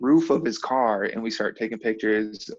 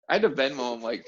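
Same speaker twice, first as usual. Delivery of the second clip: ordinary talk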